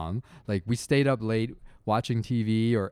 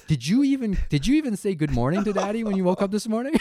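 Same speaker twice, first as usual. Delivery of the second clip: high, loud exasperated rant; dad rant; 9 semitones higher, 4 dB louder than usual. Retold or imitated. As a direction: rant at the kid like a fed-up dad. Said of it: "dad rants".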